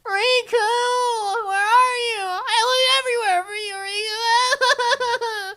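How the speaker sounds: high, far-off calling voice with a laughing whine; mock girlish calling. Mocking the girl calling out for Riku, high and whiny. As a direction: parody a girl searching for her friend.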